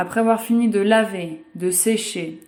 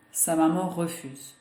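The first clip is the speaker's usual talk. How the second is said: ordinary delivery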